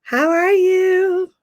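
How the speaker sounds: usual speaking voice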